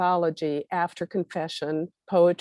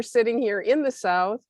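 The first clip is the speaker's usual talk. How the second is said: ordinary delivery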